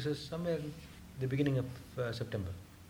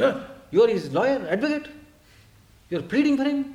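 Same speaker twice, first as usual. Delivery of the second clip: sharp, much higher and louder accusing outburst; furious retort; 8 semitones higher, 7 dB louder than usual. Retold or imitated. Swamiji snapping at the disciple who defends the worker, furious. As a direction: fire it back at him, accusing. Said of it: "shot at me and was very furious".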